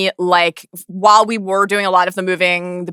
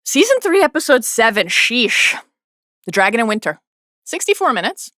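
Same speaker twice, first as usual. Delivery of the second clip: announcer; 5 semitones higher than usual. Acted. Announcing the episode.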